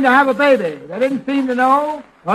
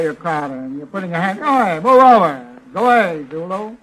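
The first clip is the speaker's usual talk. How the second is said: gruff shove-off; 5 semitones lower than usual. Acted. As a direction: bark it to push her aside. Fields shoving away Zuzu.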